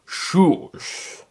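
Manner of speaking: slightly firmer, annoyed repeat. A narrator's reading. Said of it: "he repeated a little louder".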